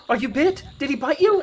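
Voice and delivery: high, loud frantic questions; alarmed mother. Acted. Mother grabs her son by the hand, acted alarm. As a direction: fire off high, panicked questions. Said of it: "and she goes".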